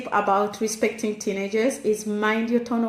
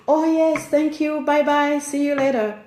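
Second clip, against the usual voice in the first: higher, louder brush-off while on a call; sing-out automatic yes and goodbye; distracted parent; 5 semitones higher, 4 dB louder than usual. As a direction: hurriedly agree just to get rid of them. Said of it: "you just say".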